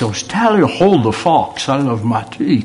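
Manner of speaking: lampoon an American gone fully British; posh British accent. Retold or imitated. Preacher mocks the oil magnate's son in a posh British accent. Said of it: "swears he's not going to speak like a Brit".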